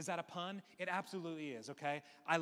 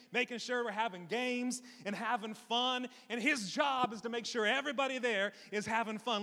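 usual speaking voice

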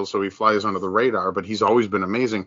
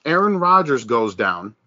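ordinary talk

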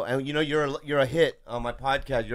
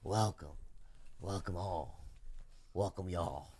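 smooth jazz host voice; 4 semitones lower, 12 dB quieter than usual. Acted. Smooth jazz host persona intro.

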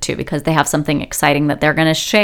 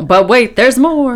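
infomercial pitchman; 8 semitones higher, 5 dB louder, slower than usual. Acted. Infomercial catchphrase.

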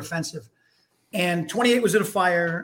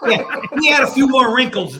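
laughing, louder and higher; 6 semitones higher, 7 dB louder than usual. Real, not acted. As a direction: joke through a laugh. Joking about photo.

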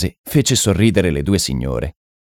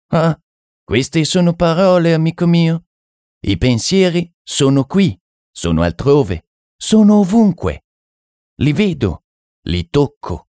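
high, swooping mystic delivery; French medium; 9 semitones higher than usual. Acted. French medium insisting his visions are real, high and emphatic. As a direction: insist with measured phrases, pitched high.

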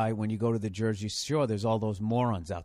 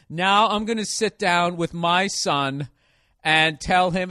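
slow, louder self-important mock voice; mocking the filmmaker; 7 semitones higher, 8 dB louder, slower than usual. Ridiculing the documentary maker, slow and louder. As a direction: ham up his solemn fatherly tone, slowly. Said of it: "he just cuts to".

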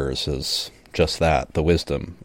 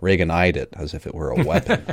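normal talking voice

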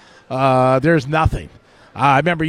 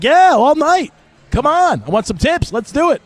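high, loud, keen to go out in the rain; eager yelling kid; 10 semitones higher, 3 dB louder than usual. Retold or imitated. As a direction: jump at the chance, all fired up. Eager kid volunteering outside.

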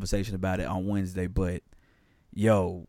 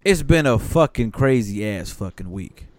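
ordinary talk, nothing marked

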